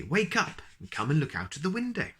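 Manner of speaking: eager calling. Acted. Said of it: "cried Mister Silly".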